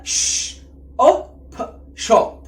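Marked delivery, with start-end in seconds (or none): 0.0-0.6 long hushing "ش"; SH sound. Shown as a demonstration. Teacher joining S and H for learners, long sh sound. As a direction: hold "ش" as a drawn-out voiceless sh. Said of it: "الاتنين مع بعض يبقى".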